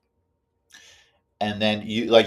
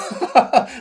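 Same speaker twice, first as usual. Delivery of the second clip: high unvoiced laugh; quick airy laugh; breathy laugh; 6 dB louder than usual. Real, not acted. Laughs at own joke.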